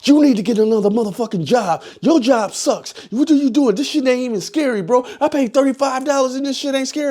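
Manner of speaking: hammed-up complaint from an angry customer; retold yelling. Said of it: "was yelling at the scare actors".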